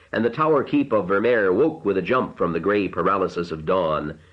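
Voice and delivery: usual speaking voice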